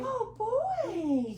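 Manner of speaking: high, happy cheer; excited cheer. Acted. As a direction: delight at the baby. Cheering the baby's bottle try.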